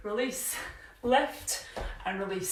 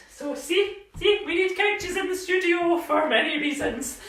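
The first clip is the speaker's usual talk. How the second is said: laughing; 7 semitones higher, 3 dB louder than usual. Real, not acted. Laughing at a couch mishap.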